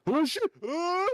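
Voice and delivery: high panicked yelp as he falls; startled yelp. Acted. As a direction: shriek in sudden fright. GM voices falling boy yelling.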